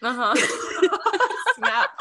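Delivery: normal talking voice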